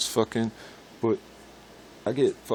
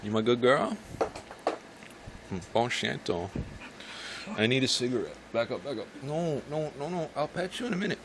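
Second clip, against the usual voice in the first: higher pet-talk voice; talking to the dog; 6 semitones higher than usual. Real, not acted. Talking to his dog while smoking, high pet voice. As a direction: coax the dog while lighting up.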